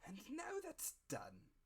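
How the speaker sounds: high, slow and satisfied; high drawn-out beggar voice; blind beggar. A narrator's reading. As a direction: close the deal with relish. Blind beggar finishes the business.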